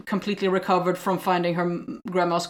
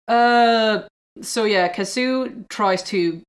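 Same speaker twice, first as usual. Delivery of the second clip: ordinary delivery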